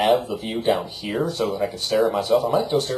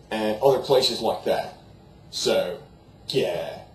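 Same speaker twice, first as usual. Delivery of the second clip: ordinary delivery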